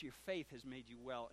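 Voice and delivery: tender. Acted. Jesus heals the blind man.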